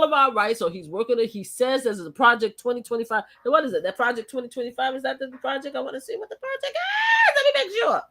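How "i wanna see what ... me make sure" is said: shouted much higher, laughing mock eagerness; mock eager. Pretending to eagerly check Project 2025, high laughing mock eagerness. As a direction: play it like a wide-eyed kid.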